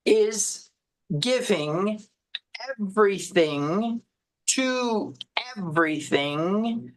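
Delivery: slow, deliberate phrasing with long pauses. Shown as a demonstration. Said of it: "let me say it a little slower".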